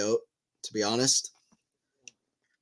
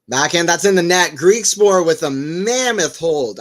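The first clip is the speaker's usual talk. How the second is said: loud, higher call, slowing on mammoth hold; excited call; 6 semitones higher, 8 dB louder than usual. Real, not acted. Commentating Griekspoor's hold for viewers, loud excited call. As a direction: belt out the big hold like a commentator. Calling a huge service hold.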